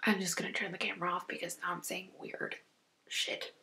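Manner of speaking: hushed, breathy whisper. Real, not acted. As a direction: whisper it like a quick secret. Turning camera off, whispering.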